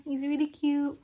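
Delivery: ordinary talk, nothing marked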